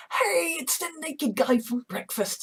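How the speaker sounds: high cheerful voice. Acted. Jim greeting Jeff.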